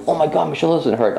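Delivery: high, excited astonishment; amazed joy. Retold or imitated. Woman slapping her healed shoulder, astonished. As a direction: cry out in delighted disbelief. Whacks shoulder, finds no pain.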